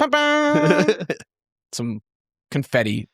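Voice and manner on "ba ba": high sung-out ta-da; mock fanfare. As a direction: toot a little high fanfare. Imitates fanfare as character pops out.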